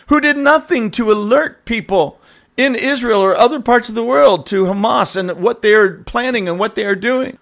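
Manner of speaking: mocking Western bleeding hearts. Mimicking people in the West pitying Palestinians, high and sarcastic. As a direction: lay on fake pity with heavy sarcasm.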